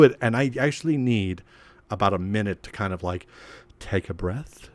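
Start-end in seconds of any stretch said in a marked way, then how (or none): none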